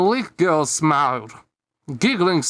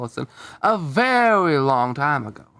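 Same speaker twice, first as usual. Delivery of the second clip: plain reading voice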